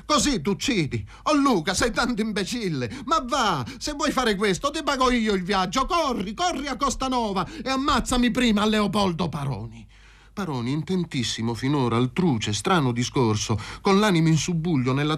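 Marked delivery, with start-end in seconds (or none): 0.0-9.9 high-pitched agitated shouting without much volume; crying out. A narrator's reading. Mazzarini shaking Luca out of suicide, crying out. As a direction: exclaim urgently, pitched way up. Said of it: "mi scosse e mi gridò".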